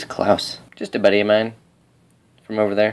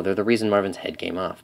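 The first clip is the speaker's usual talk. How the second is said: ordinary delivery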